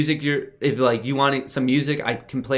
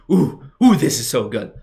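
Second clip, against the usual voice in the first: excited; 6 dB louder than usual. Real, not acted. Delighted by fan art.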